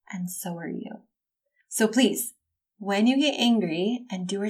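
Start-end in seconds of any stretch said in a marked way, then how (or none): 0.1-1.0 soft, voiceless whisper; whispered reassurance to the listener. Real, not acted. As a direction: breathe it to them like a secret. Tender closing aside to listener.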